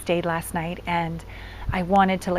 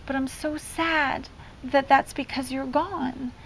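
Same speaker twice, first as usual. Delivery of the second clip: put-on higher, slow, mildly plaintive child; retold tearful confession; 5 semitones higher, 4 dB quieter, slower than usual. Son misses his absent mom, retold tearful confession. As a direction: imitate a sad child confiding gently. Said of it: "so he started crying".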